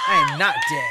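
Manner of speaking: thrilled high shout; excited shout. Real, not acted. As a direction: yell it in disbelief. Player realises character survived.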